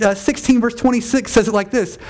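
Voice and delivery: ordinary delivery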